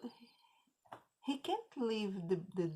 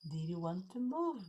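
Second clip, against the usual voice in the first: ordinary delivery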